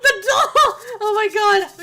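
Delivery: loud, high, slowed laughter on the doll; laughing in disbelief; real shrieking laugh; laughing shock. Real, not acted. Startled by animate doll.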